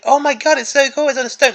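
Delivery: mock excited fan gush; mock enthusiasm. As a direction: parody a fan gushing over the model. Mocking imagined designer enthusiasm.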